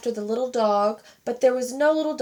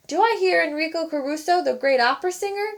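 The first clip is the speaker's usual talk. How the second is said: teasing; 6 semitones higher, faster than usual. A narrator's reading. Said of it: "the pastry cook teased".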